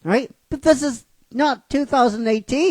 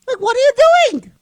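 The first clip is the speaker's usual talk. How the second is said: fast, much higher, louder squeak of disbelief; exasperated squeal; 11 semitones higher, 5 dB louder, faster than usual. Real, not acted. Mocking the studio's budget, high exasperated squeal.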